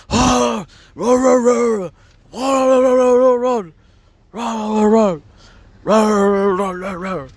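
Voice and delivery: high, loud mock dog-like roaring; dogs barking. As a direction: imitate a row like dogs going wild. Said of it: "Sound like fucking dogs over there".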